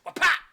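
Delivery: loud, high-pitched slap noise; explosive vocal whack; imitated smack. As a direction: punch out a cartoon smack sound.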